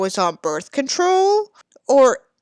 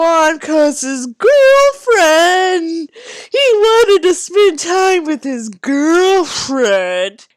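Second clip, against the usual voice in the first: slow, high, loud, drawn-out mockery of "girlfriend"; mocking voice; 7 semitones higher, 8 dB louder, slower than usual. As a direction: drag out "girlfriend" to ridicule him. Mocking the character's excuse.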